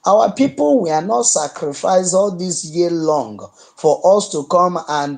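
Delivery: ordinary talk, nothing marked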